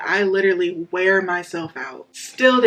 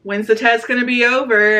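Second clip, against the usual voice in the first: impatient snark, pitch a bit raised; snarky; 5 semitones higher, 5 dB louder than usual. Retold or imitated. Praying to God as confidence dwindles, snarky. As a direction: retell it as a snarky demand. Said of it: "it kinda turned into snarky".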